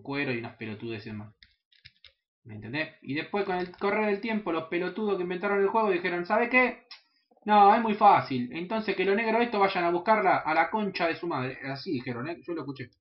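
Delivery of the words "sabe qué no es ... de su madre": a light mock of smug game developers; mildly voiced snooty devs. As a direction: sneer it like a lazy developer. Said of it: "Con, con esa voz".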